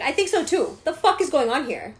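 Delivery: high, loud indignant comeback; angry retort. Retold or imitated. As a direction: re-enact a furious teenager. Retold teen argues with man.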